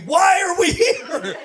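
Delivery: high, slow, loud cry of why; exasperated shout. Acted. Comic to audience, demanding an answer, slow and loud. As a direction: bellow the question at the sky.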